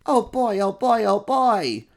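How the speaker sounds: high loud squeal of delight; excited monsters. Acted. Little monsters squeal about the coming parade. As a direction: bounce with glee.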